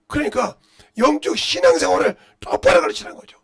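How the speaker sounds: loud, high-pitched, impassioned sermon; full-force preaching. Real, not acted. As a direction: shout it out to the congregation.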